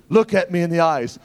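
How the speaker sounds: loud, slowing to a drawn-out order; loud urgent faith command; fervent command. Retold or imitated. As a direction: command him to stand, loud and slow. Commanding man to walk.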